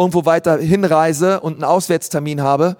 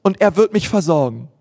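higher, gentle and reassuring; calm the worried friend; trusting squirrel; 6 semitones higher than usual. Acted. Squirrel reassures the sparrow that God provides, gently higher. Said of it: "Eichhörnchen ... sagt".

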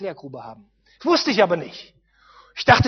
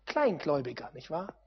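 ordinary talk

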